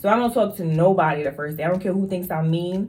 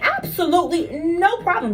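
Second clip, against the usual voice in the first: higher, crisp and unbothered; brisk, polite authority; same firm tone; 8 semitones higher than usual. Retold or imitated. Nurse brushing off a demanding relative, crisply polite. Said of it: "just like that same tone".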